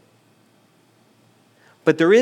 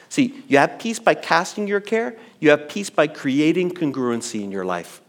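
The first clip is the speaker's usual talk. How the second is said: ordinary delivery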